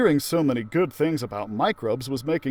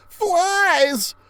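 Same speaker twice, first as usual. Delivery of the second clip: much higher, loud frightened shout; alarmed cry; 5 dB louder than usual. Acted. Narrator kid warning the class about flies, high frightened shout.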